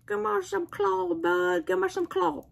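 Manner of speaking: playful high voice asking a toy for claw. Pretend. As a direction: tease the toy for a high five. Playing with toy.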